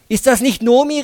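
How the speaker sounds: high, loud call across the street; excited calling. Acted. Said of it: "riefen die Frauen". (aufgeregt laut rufen)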